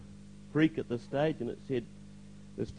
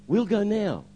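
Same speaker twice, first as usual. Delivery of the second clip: higher, louder retold command; urgent insistence; 9 semitones higher, 5 dB louder, slower than usual. Pastor looks hard at the young man, urgent insistence. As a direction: press for action at once.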